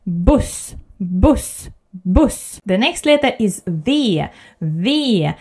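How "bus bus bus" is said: bus short with chin forward; short Swedish U. Shown as a demonstration. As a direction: bus with a bigger opening. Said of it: "push your chin a little bit forward".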